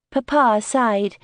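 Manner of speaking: ordinary delivery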